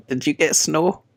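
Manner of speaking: usual speaking voice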